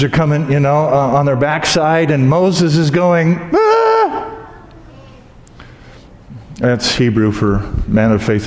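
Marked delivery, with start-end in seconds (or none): 3.5-4.3 high mock shriek of panic; panicked scream. Moses trapped at the Red Sea, mock panic shriek.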